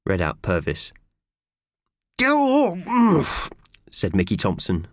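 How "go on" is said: muffled. A narrator's reading.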